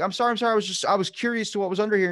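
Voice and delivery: high, hurried panicked apology; freaking out. Retold or imitated. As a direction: blurt out excuses like a caught kid. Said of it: "I'm, like, freaking out".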